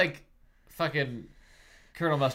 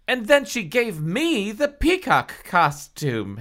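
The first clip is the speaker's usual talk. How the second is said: high, louder plaintive wail; 11 semitones higher, 5 dB louder than usual. Acted. Halle explains traded costumes, wailing. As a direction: wail it like a melodrama victim. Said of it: "Halle wailed".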